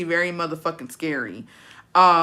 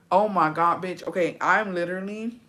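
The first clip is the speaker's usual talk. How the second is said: usual speaking voice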